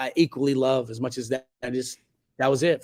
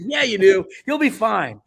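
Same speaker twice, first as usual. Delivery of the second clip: breezy reassurance; 8 semitones higher, 5 dB louder than usual. Retold or imitated. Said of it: "He's like".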